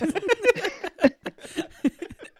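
laughing out loud; short high burst of laughter. Real, not acted. Laughs at casque versus masque mix-up.

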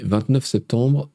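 normal talking voice

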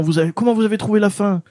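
high, quick mock fan question; eager fan voice. Fans pressing him on the finale, high and hurried. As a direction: rattle it off like a pushy fan. Fans asking about the ending.

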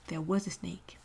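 soft whisper; whispered demo. Shown as a demonstration. Teacher whispering the past-tense example. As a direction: whisper the line.

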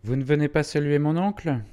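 high, loud call to family; loud question climbing high; prodding question. A narrator's reading. Minoret prodding his wife and son.

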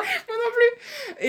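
high squeal of laughter; laughing. Real, not acted. Laughing at birthday coincidence.

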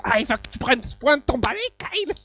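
high cartoon kid voice, shouting the name; squeaky cartoon brat scolding; Eric Cartman. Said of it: "le mec qui fait Eric Cartman". (voix de dessin animé aiguë)

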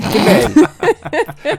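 Corsican questioning lilt on "T'es belle", very slow, rising high at the end; Corsican accent. Shown as a demonstration. Host teasing in Corsican accent, slow rising question. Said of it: "l'interrogatif corse".

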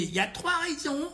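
high, mocking imitation of a crank; squeaky voice to make fun of her. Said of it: "avec une voix de Cresset".

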